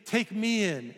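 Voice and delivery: high, pleading mock beggar; a stranger begs to be let in, hammed-up pleading; begging. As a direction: plead like a desperate stranger, stretching out "take me in". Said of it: "begging you".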